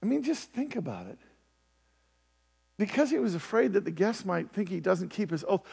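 usual speaking voice

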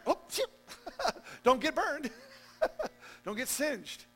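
high laughing cry of mock alarm; laughing mock fright. Real, not acted. Preacher joking to crowd about getting burned, laughing mock fright. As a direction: yelp it through laughter.

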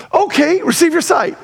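high-pitched, breezy retold okay; casual granting. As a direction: grant it as if it's no big deal. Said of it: "Look at the way it's said".

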